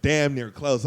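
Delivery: normal talking voice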